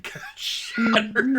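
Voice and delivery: laughing through the words, slowed. Real, not acted. Telling the table the joke, laughing. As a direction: giggle out the punchline.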